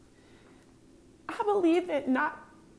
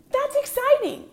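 excited; 9 semitones higher than usual. Real, not acted. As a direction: pitch jumps higher, bright burst. Enthusing about game excitement.

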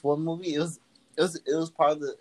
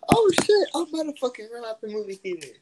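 high panicked cries; terrified screaming; 4 dB louder, slower than usual. Retold or imitated. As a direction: scream in terror. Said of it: "didn't feel any type of that way".

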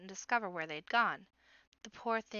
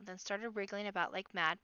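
usual speaking voice

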